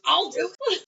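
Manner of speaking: imitating eagerness, voice jumping high; high-pitched, over-eager volunteering; so excited. Otis volunteering to gut the fish, so excited. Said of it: "Just so excited".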